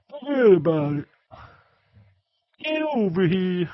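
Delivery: put-on loud, high-pitched Boston accent; hammed-up Yankee shout in a Boston accent. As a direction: belt it out like a cartoon Bostonian. Mimics Boston accent after naming it.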